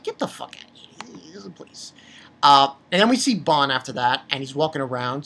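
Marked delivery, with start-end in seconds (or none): 0.0-1.9 whispered, softer pretend brush-off; mock whisper. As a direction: hiss it under your breath. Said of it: "I'm like".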